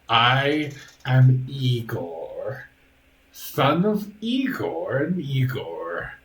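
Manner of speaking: slow, drawn-out creature introduction; Igor, creepy servant. Acted. Stitched-together butler introduces himself to guests, creepy and formal. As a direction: stretch it out like a ceremonious stitched servant.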